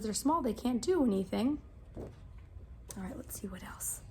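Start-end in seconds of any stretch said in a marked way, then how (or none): 2.0-4.0 whispered aside, much softer; soft whispered page-turn aside. Real, not acted. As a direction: hush it to yourself.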